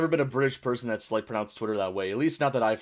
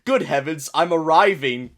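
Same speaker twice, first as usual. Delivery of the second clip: hammed-up British accent, building louder; mock-British ecstasy; 3 semitones higher, 6 dB louder, slower than usual. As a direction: play a very proper British climax. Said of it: "British people having sex be like".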